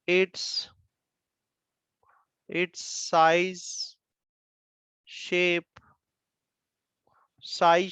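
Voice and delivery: word by word, waiting for pens to catch up; slow dictation with long pauses. Acted. Teacher dictating the elastic energy definition word by word, slow dictation. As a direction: dictate slowly, repeating phrases as the class writes.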